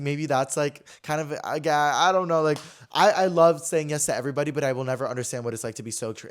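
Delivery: normal talking voice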